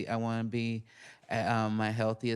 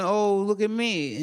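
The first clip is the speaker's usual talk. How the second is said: higher, slow, mocking vanity; mock self-absorbed voice; 8 semitones higher, 5 dB louder, slower than usual. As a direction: play a self-absorbed show-off. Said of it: "it's you going".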